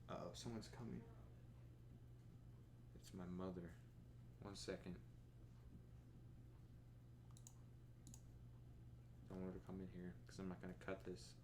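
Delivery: hushed, so mum won't hear. Real, not acted. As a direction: keep it down, someone's near. Hiding recording from approaching mother.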